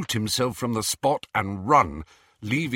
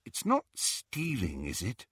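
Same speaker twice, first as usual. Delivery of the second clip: troubled; 6 dB quieter than usual. A narrator's reading. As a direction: ask uneasily, fading away. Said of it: "asked Hermione in a troubled voice".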